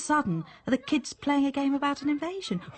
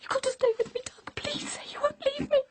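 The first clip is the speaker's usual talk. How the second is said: higher, tearful begging; frightened pleading voice; desperate pleading; 13 semitones higher, faster than usual. Acted. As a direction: beg him not to leave you. Wife begging husband not leave.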